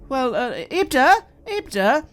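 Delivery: loud high call across the house; girl calling loudly for her father. Acted. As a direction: shout his name to reach upstairs.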